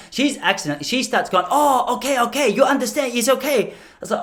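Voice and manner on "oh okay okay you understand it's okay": high put-on Japanese accent; mother-in-law's Japanese accent. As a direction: imitate someone overdoing a Japanese accent. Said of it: "took it a little bit too far".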